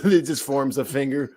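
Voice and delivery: ordinary talk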